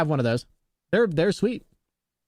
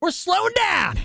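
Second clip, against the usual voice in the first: playful voicing of a truck, building to a higher, shouted finish; mock truck scream; 4 semitones higher than usual. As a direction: hype it up like the truck is yelling at you, ending in a shout. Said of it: "I want it to scream at me like".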